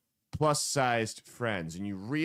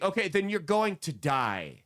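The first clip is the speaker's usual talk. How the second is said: usual speaking voice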